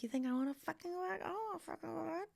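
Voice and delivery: mock the sarcastic teenager fast; quick mocking sarcastic reply; snarky teen retort.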